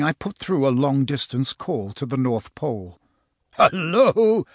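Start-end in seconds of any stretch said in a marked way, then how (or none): 3.5-4.4 lightly voiced jolly Santa, pitched up; big, rounded hello, lifted high; chuckled, rumbly Santa. A narrator's reading. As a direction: answer the telephone with a hint of chuckle. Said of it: "chuckled a rather deep, rumbly voice".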